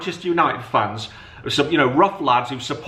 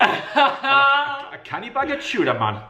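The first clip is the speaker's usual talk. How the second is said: put-on Geordie accent; 11 semitones higher, faster than usual. Dad keeps joking in a Geordie accent for his son. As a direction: ham up a Geordie shop order. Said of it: "I'm not gonna do my Geordie accent".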